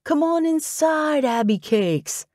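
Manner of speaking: high, slow, wheedling invitation; coaxing ex. A narrator's reading. Ex inviting her into her own apartment, coaxing. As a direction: coax in a drawn-out, high lilt.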